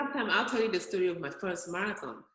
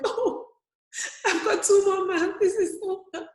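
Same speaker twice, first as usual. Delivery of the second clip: higher, louder, laughing through the strain; laughing exhausted; 11 semitones higher, 5 dB louder than usual. Retold or imitated. Exhausted runner at mile twenty-four, laughing through the strain. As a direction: laugh at how hard it is.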